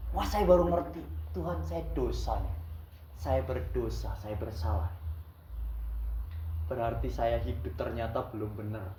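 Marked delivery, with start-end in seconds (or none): 1.3-8.9 quiet confession. Retold or imitated. Confessing sin to God.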